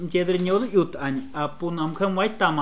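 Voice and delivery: normal talking voice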